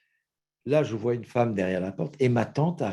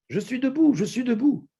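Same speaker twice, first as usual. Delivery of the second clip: high, louder, overjoyed; excited cry; 12 semitones higher, 5 dB louder than usual. Retold or imitated. Healed woman shouts down the phone, high and thrilled. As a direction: burst out with joy.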